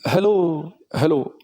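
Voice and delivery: two hellos with a clear gap; two separate hellos. Shown as a demonstration. Teacher shows an echo heard as two words. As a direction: say hello twice, spaced apart. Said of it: "ऐसे सुनना है".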